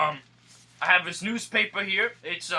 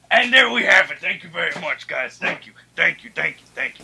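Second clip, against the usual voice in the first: showy thank-you; 8 dB louder than usual. Acted. Host bows to applause.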